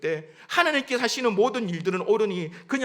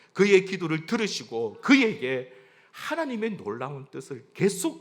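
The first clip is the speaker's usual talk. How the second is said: ordinary talk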